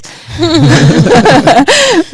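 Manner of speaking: big bright burst of laughter, high and loud. Real, not acted. Speaker cracking up at her own download joke, loud laugh.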